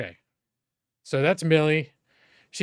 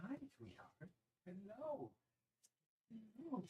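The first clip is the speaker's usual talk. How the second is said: higher, soft cooing at a pet; 8 semitones higher, 25 dB quieter than usual. Real, not acted. Cooing at the puppy.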